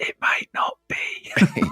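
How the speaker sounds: slow hushed whisper. Pretend. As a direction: whisper it back conspiratorially. Echoing the secret in whisper.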